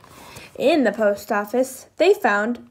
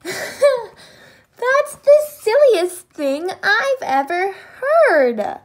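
high, loud, drawn-out amused ridicule; laughing; 8 semitones higher, 6 dB louder, much slower than usual. A narrator's reading. Lion ridicules Alligator's cabbage leaf answer, laughing. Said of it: "laughed Lion".

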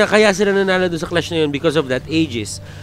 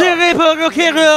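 hammed-up Japanese accent, slowed; joking Japanese accent on the name; mock Japanese accent; 7 semitones higher than usual. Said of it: "That is a Japanese accent".

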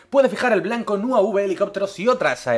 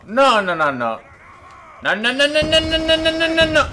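higher, rising panicked pleading; 10 semitones higher than usual. Real, not acted. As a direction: beg it not to happen. Dreading enemy approach in game.

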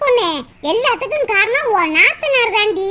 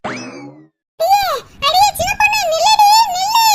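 higher call building to a loud shout; 6 semitones higher, 3 dB louder, slower than usual. Acted. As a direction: call her back, ending in a full shout. Neighbour calls after storming woman.

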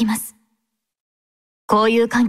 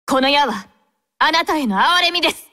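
stern battle cry; 3 semitones higher than usual. Acted. Battle lines condemning an enemy.

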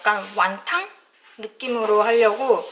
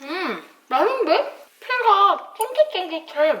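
delighted tasting; 9 semitones higher, 4 dB louder, slower than usual. Real, not acted. Tasting freshly cooked dumplings.

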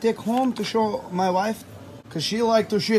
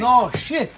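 "No, shit." with NO punched loud and higher; stress on NO; 4 semitones higher, 4 dB louder than usual. Acted. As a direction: hit "No" hard, then "shit." plainly. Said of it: "insists on the word".

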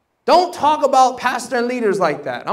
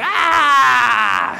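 higher, louder mocking cry; mock angel cry; 5 semitones higher, 4 dB louder than usual. Angels laughing at him, mock panicked cry. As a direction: lampoon his own panic.

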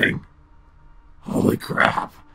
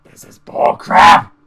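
slow loud angry outburst; 7 semitones lower, 13 dB louder, much slower than usual. Real, not acted. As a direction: spit it out, fed up. Angry after scare.

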